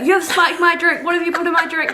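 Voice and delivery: mock his outrage while giggling; hammed-up accusing drunk; mocking drunk passenger.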